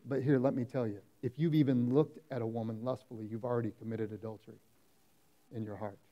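quiet, grave delivery of Jesus' words; hushed quoting. Retold or imitated. Preacher quoting Jesus to the church, softly and gravely.

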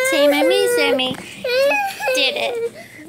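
celebrating the finished rescue, triumphant. A narrator's reading. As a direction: announce the win.

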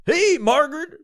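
loud, high DJ parody; radio dude voice. Retold or imitated. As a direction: talk to your wife like you're on air. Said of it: "a fucking radio dude can't stop doing it".

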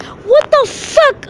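mock outraged wrestler. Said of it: "they're like".

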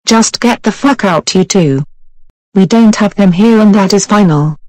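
loud, furious dismissal; angry shouting. Acted. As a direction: throw them out at full volume. Throwing customers out angrily.

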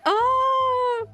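high, drawn-out excited oh; delighted squeal. Real, not acted. Fan watching character demo start, high delighted squeal. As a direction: squeal with delight on one long note.

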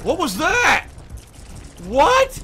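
loud, very high disbelieving yelp; startled shout. Real, not acted. Gamer startled by a sudden glitch, surprised yelp. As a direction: jolt up in shock.

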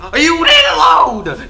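slow, high, loud outburst; exasperated shout. Real, not acted. Player yells at the game for not loading. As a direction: yell at the game.

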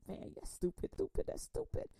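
inner scolding voice. Retold or imitated. Inner voice scolding yourself for doubting someone. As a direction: mutter stupid then pitch it way up. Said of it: "your mind is, you going like".

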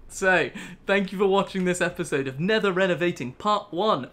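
usual speaking voice

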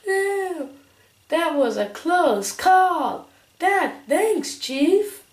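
high loud squeaky voice; old voice. Acted. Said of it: "that was my old voice".

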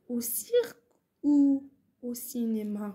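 very slow, stretched-out dictation. Shown as a demonstration. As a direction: dictate the sentence slowly, drawing out each part. Dictating a sentence for pupils. (إملاء الجملة ببطء شديد)

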